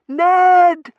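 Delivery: high, long full-voiced call; shout. A narrator's reading. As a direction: yell his name from outside. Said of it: "Verity shouted".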